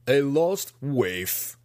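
slow scornful master's voice; mocking, drawn out. Master scoffing at the dead child, slow and mocking. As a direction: sneer it like a cruel teacher. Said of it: "He laughed to his students".

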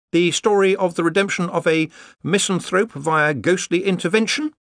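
high, prompted scholarly voice; reading aloud haltingly; Ponder voice. A narrator's reading.

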